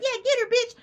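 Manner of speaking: high, loud mock egging on; hype friends cheering. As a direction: cheer her on like a rowdy friend. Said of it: "They're like".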